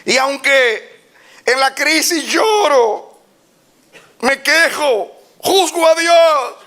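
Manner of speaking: high, loud fervent preaching, slow stretches; preaching at full force. Real, not acted. Preacher rousing the congregation, fervent. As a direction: stir the congregation up.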